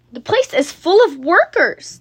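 shouting. A narrator's reading. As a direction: shout it as a puzzled townsperson. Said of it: "the people shouted".

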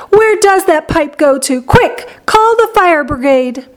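high-pitched urgent alarm; yelling. A narrator's reading. Mother calls for the fire brigade, yelling. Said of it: "yelled Missus Gloop".

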